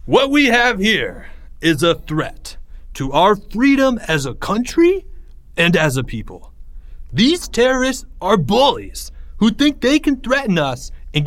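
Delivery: high, emphatic oratory; rousing speech. A narrator's reading. Greg on the bench before a near mob, rousing. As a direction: address the crowd like a politician rallying a nation.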